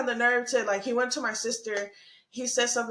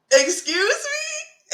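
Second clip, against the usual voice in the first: high, drawn-out mock disbelief; loud, stretched, squeaky-high questioning; incredulous; 13 semitones higher, 5 dB louder, much slower than usual. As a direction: act out an offended double take, pitch shooting up. Retelling her shocked reaction to him.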